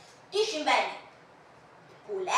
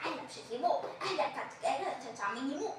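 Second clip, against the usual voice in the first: hushed lover's call; 11 dB quieter than usual. Acted. Lovers parting in secret.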